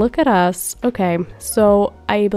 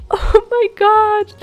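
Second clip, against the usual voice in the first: high, slow, stretched-out gush; gushing excitement; 11 semitones higher, 4 dB louder, slower than usual. Real, not acted. Gamer gushing to viewers about the new couple, thrilled. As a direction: draw it out, overwhelmed with joy. Squealing over prom girlfriend news.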